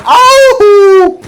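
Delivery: loud high yelp of pain. Real, not acted. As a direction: yelp out suddenly. Sudden cry in game.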